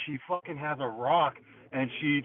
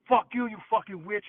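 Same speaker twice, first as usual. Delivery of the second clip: retold insult; 8 semitones higher than usual. Throwing rock at witch.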